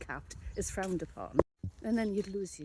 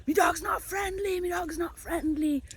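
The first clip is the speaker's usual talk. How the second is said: shouting warnings across the street; mock shout; 9 semitones higher than usual. Man warning a distant woman, mock shouted. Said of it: "he was shouting at the woman".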